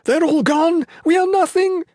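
very high, loud despairing cry; panicked exclamation. A narrator's reading. Nolan despairing as the army is destroyed, panicked cry. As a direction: wail it out loudly in panic. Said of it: "Nolan exclaimed".

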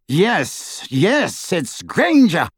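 triumphant cry of recognition; cried out. Acted. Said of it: "cried Lucius".

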